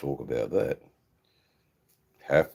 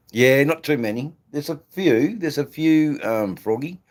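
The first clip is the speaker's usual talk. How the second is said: calling out; 10 semitones higher, 8 dB louder than usual. Real, not acted. Calling out to birds outside.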